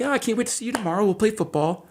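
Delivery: a light imitation of a cheerful eight-year-old; sudden sweet kid voice. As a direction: flip to bright and eager, like nothing happened. Boy suddenly sweet again.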